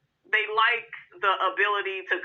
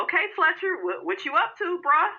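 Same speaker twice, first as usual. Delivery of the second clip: high, suspicious sassy Nell; mock Miss Nell; 9 semitones higher than usual. Vlogger voices Nell questioning Chris about the surprise guest, high and suspicious. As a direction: lightly play the wife eyeing her husband. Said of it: "Miss Nell looking like".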